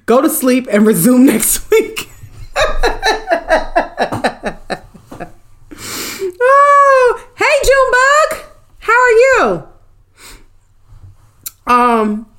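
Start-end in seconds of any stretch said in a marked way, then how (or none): 2.4-4.8 long high giggling laugh; real laughter. Real, not acted. Streamer cracking up at her own bit, laughing. 6.5-9.7 very high, squealed hello; delighted greeting. Real, not acted. Streamer spotting a viewer she knows, delighted.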